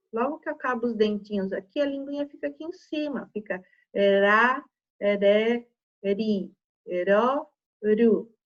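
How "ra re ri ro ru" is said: "ra, re, ri, ro, ru" com R de um toque da língua; R fraco. Shown as a demonstration. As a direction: diga "ra, re, ri, ro, ru" pausado, língua no céu da boca. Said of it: "Esse que é o som de um R". ("ra, re, ri, ro, ru" com R fraquinho)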